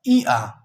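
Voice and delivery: ई gliding into आ; diphthong demo. Shown as a demonstration. Teacher sounding a diphthong for the class. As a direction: glide ई आ smoothly. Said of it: "ये कैसे अदा की जाती है?".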